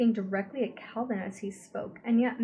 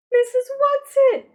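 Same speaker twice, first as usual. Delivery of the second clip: very high, loud despairing cry; despairing call; 15 dB louder than usual. A narrator's reading. Meg calling out despairingly for Missus Whatsit. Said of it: "Meg called despairingly".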